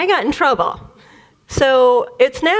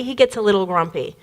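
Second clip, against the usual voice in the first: ordinary talk, nothing marked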